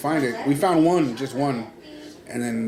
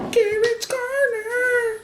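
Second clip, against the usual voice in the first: very high, slow singsong segment announcement; announcer voice; much slower than usual. Acted. Host announcing the segment name, high and slow. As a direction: present the segment title like a jingle.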